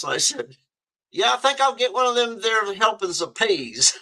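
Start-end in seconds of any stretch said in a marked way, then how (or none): 1.1-4.0 hammed-up Southern accent, pitched higher; mock Southern. Student mocking the serving lady's accent back at her, hammed-up Southern. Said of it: "you imitate 'em, a Southern person".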